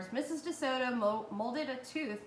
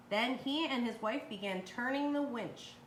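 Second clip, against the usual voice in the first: usual speaking voice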